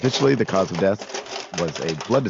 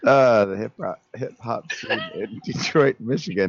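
laughing; 4 semitones higher than usual. Real, not acted. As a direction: laugh through the words.

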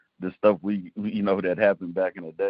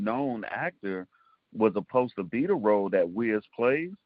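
ordinary talk

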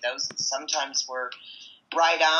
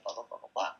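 dismissive mutter; 8 semitones lower, 9 dB quieter, much faster than usual. Retold or imitated. Trailing off the repeated advice.

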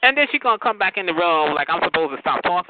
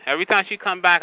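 ordinary delivery

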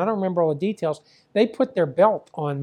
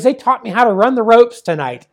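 put-on excited kid's boast, higher and much louder; retold elation; 5 semitones higher, 9 dB louder than usual. Son showing dad his welts, retold elation. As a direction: imitate a thrilled son, loud and proud. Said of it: "the kid was in seventh heaven".

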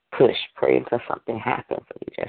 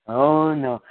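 mock whining. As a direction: imitate a whiner, half-seriously. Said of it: "until we stop whining and whipping".

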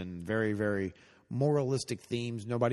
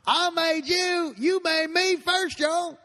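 high, loud slow twang; drawn-out Southern pop star; [name] voice; 6 dB louder, much slower than usual. Pretend. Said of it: "[name]".

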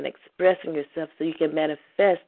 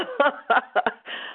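high laugh; 9 semitones higher than usual. Real, not acted. Emotional host breaks into laughter.

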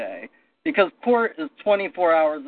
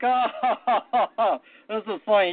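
imitated scoffing laugh, higher pitch; mocking laugh; 5 semitones higher, slower than usual. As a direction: laugh it off like a dismissive prosecutor. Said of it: "and he laughs at us".

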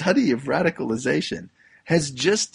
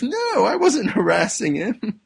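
bully denying; 10 semitones higher, 5 dB louder than usual. Retold or imitated. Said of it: "And the bully says".

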